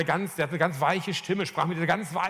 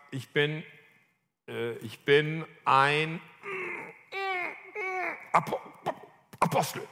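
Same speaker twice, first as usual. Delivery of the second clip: halting, stuck before the last word; acted-out struggle to get the words out; struggling to say it; slower than usual. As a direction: stammer and strain towards APOSTEL, with long gaps. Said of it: "Das war richtig schwer".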